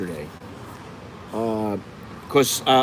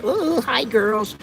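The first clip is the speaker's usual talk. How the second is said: put-on much higher, sing-song "hi girls"; mock sweet greeting; 11 semitones higher, much slower than usual. Vlogger pretending to greet the waitresses, high and sugary. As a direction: chirp it like a sugary hello.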